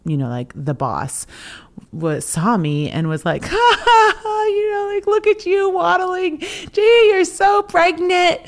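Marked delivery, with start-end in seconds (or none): 3.5-8.4 much higher, louder cackling bossy auntie teasing; a loud, much higher voice that hams up the teasing; Aunt Lorraine. Retold or imitated. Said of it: "hysterical and grouchy".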